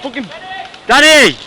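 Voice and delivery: shouting a name. Real, not acted. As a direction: call out sharply. Yells name after hit.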